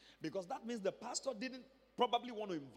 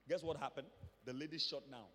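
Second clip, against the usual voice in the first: usual speaking voice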